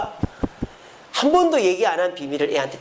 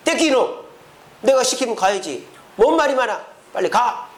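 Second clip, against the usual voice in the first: mock scolding, pitched up in bursts; 5 semitones higher than usual. God imagined ordering reluctant Moses to go, scolding. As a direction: play a stern telling-off. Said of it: "이렇게 야단 호통을 치신 게 아니라".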